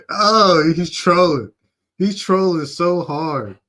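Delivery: high, loud, drawn-out exclamation; amused disbelief. Real, not acted. Reactor decides the stiff client is trolling, amused disbelief. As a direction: call it out loudly, cracking up.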